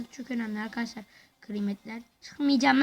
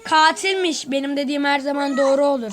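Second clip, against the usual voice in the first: laughing gloat; 5 semitones higher, 7 dB louder than usual. Real, not acted. Gloating the killer was found.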